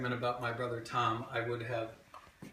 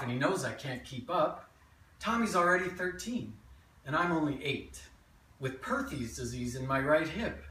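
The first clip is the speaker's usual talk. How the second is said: lively memoir monologue with swinging pitch; animated childhood tale, present tense; stage storytelling; 5 semitones higher, 4 dB louder than usual. Acted. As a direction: tell it to a live audience as your younger self. Performs a written memoir monologue.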